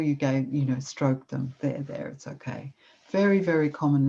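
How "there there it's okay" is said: soft comforting murmur; comforting a suffering friend softly; soothing there there. Shown as a demonstration. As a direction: soothe someone in pain, gently. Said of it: "your voice was gentle".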